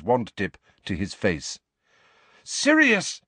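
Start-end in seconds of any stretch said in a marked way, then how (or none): 2.4-3.2 high, urgent cry of alarm; high-pitched urgent shout; loud call. A narrator's reading. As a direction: call out sharply to stop him. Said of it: "said Harry loudly".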